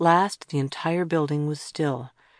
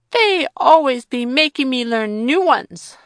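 put-on sulky child; 9 semitones higher, 8 dB louder than usual. Child Joe complaining.

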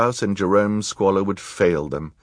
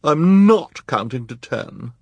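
sulky, drawn-out refusal; petulant whine; pouting; 7 semitones higher, 6 dB louder, slower than usual. A narrator's reading. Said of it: "Esme pouted".

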